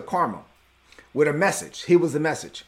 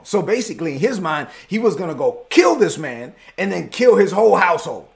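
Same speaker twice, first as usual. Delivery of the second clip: ordinary talk, nothing marked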